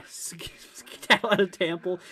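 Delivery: whispered through a laugh. Real, not acted. Rereads chat line, amused.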